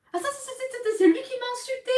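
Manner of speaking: very high, loud squealed protest; excited indignation. Real, not acted. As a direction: stammer into a high, loud accusation. Insists he was the insulter.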